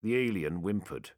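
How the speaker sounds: plain reading voice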